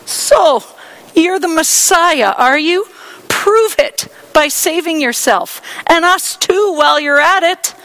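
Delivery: high, loud sarcastic sneer; scoffing. Acted. Criminal beside Jesus scoffing, loud sneer. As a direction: goad him with contempt.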